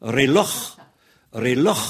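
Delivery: "Reloj" ending in a rasped kh; "Reloj" twice, scraping the final j; Spanish J. Shown as a demonstration. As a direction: say "Reloj" with a throaty Spanish jota. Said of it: "c'est le J espagnol".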